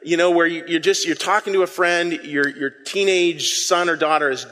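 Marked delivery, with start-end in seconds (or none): none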